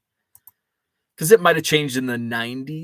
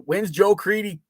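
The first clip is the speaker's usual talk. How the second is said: lightly imitated Chicago accent, pitched up; a higher-pitched hint of a Chicago fan's accent; attempted Chicago accent; 7 semitones higher than usual. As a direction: have a half-hearted go at a South Side Chicago accent. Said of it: "that South Side Chicago accent guy".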